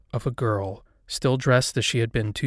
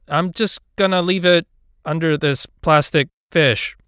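raised, higher voice; calling out; 6 semitones higher, 7 dB louder than usual. A narrator's reading. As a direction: announce it loudly to someone out of view. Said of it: "I called".

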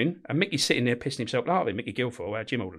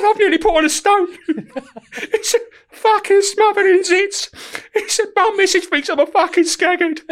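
very high, loud, slow, through laughter; imitating a furious prisoner while laughing; laughing complaint; 7 dB louder, slower than usual. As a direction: tell his grievances while cracking up. Prisoner complaining, teller cracking up.

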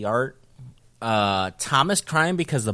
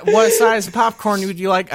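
higher, louder server voice; cashier voice; 7 semitones higher, 7 dB louder than usual. Retold or imitated. Cinema cashier asking the customer about popcorn size, higher and louder. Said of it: "they said".

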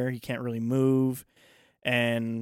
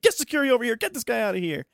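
much higher, louder command; retold order to throw him out; 10 semitones higher, 3 dB louder, faster than usual. Staff throwing Randall out of the hospital, higher, louder command. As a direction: order security in, sharply.

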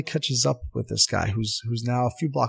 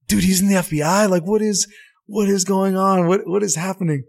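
louder, higher, excited disbelief; stunned disbelief; 9 semitones higher, 6 dB louder than usual. Retold or imitated. Teammates in the back seat, stunned and exclaiming. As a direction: exclaim in shocked amazement. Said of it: "And we're just like".

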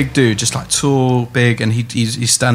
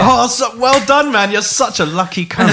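high, drawn-out mock congratulations; gushing, faintly begrudging praise; bitter congratulations; 10 semitones higher than usual. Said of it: "'Cause there's bitterness".

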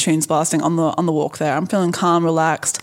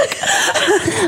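high laugh; 14 semitones higher than usual. Real, not acted. Big laugh at shallow joke.

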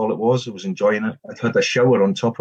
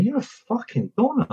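mocking insult; 13 semitones higher than usual. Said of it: "And I'm going".

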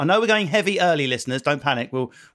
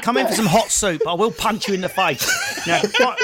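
mock sweet woman turning to threats through laughs; hammed-up hostess, louder and laughing; kind but not nice; 5 semitones higher, 3 dB louder than usual. Mocking a kind woman offering soup, then threats.

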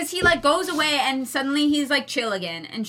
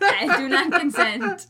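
slow, higher protest through laughter; amused, drawn-out refusal; laughing protest; 5 semitones higher, 3 dB louder, slower than usual. Real, not acted. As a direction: object firmly while cracking up. Objecting while both are laughing.

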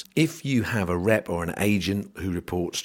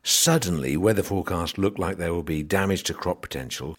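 ordinary delivery

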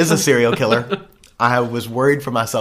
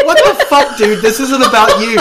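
mock incredulous; 9 semitones higher, 8 dB louder than usual. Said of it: "looking at you like".

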